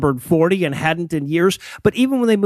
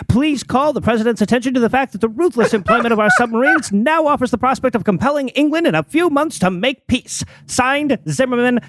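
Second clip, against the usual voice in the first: put-on higher, formal old telegram reading; old-timey voice; 5 semitones higher than usual. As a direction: read it like an old newsreel announcer. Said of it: "in an old-timey voice".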